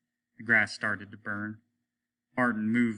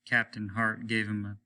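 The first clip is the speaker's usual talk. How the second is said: ordinary delivery, nothing marked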